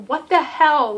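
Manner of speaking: retold shock, scolding a character; mock-furious demand; outraged.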